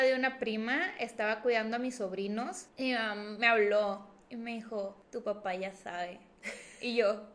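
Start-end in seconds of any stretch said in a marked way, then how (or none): 5.1-6.2 low, soft, slow retold warning; grave news. Mother warns daughter that dad already knows, grave and hushed. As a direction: break the bad news quietly. Mamá da la mala noticia.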